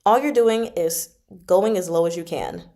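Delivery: ordinary talk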